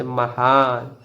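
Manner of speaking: महान with the हा held long; long vowel. Shown as a demonstration. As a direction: stretch out the हा in महान, slowly. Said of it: "तो इसमें समय लगेगा". (महान में हा को लंबा खींचकर)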